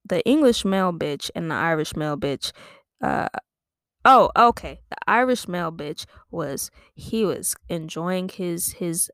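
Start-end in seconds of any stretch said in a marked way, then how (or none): none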